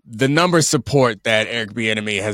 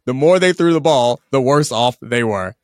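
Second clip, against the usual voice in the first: excited; 4 semitones higher, 7 dB louder than usual. Real, not acted. Incredulous about stats.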